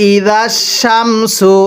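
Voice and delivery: ই জাস Shamsu drawn out slowly, each syllable held; slow teaching demo of ই জাস Shamsu. Shown as a demonstration. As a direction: spell it out slowly for students. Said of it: "মিলিয়ে পড়ব।".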